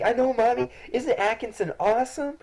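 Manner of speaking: put-on little girl Isabella. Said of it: "and is like".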